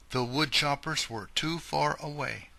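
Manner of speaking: ordinary delivery, nothing marked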